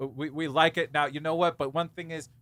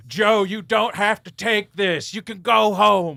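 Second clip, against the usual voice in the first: slow ghost voice; 5 semitones higher, 7 dB louder, slower than usual. Pretend. Father ghost in the clouds.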